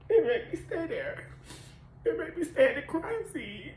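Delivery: fighting back tears on each repeat; real grief breaking through a repeated line. Real, not acted. Voice breaks recounting son's death.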